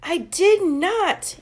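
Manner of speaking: high, loud, slow denial; angry yell. A narrator's reading. Max denying to Chloe, slow loud yell. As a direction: shout it back, defiant. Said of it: "yelled Max".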